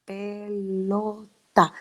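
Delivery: put-on very slow, drawn-out child's repeat; imitating a child. Therapist imitating a child repeating the word, very slow. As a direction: stretch the word out like a small child sounding it. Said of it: "y el niño dice".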